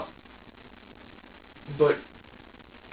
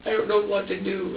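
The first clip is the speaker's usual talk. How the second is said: imitating a helpless look; 6 semitones higher than usual. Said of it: "he's just looking at me like".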